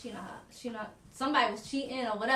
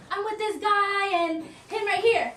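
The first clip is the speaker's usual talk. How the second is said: imitating a tipsy teacher, high and laughing; giggly teacher confession; 8 semitones higher, 5 dB louder than usual. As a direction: laugh through it, higher and louder, like a giddy confessor. Retelling a teacher's bar confession.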